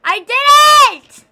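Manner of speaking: high, very loud drawn-out "I didn't"; slow full-volume shriek of "I didn't"; shouted denial. Real, not acted. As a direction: yell "I didn't" with everything. Shouting down chat.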